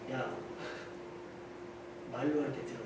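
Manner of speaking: low, quiet warning from an adult; restrained scolding. Acted. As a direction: scold under your breath, held back. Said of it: "야단했을 때 뭐라고 그러죠?". (낮고 조용하게 타이르는 경고)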